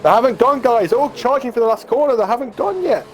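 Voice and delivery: high, urgent race call; excited. Real, not acted. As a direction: call the restart with rising tension. Cars charging at restart.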